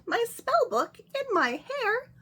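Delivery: high, loud, slow shriek of surprise. Acted. Witch pulling her spell book from her hair, shrieking. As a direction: shriek it in amazement. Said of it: "She shrieked".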